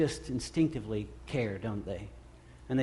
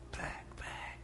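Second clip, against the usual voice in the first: hushed whisper of back, back; 18 dB quieter than usual. Retold or imitated. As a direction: breathe it out unvoiced. Jesus calling over crowd, whispered.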